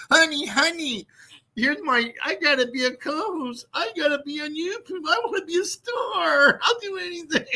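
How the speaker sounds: high, tearful pleading mock voice; mock whining husband. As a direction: whimper like a man begging his wife. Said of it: "I mean, like".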